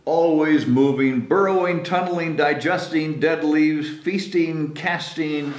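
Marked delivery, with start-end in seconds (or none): none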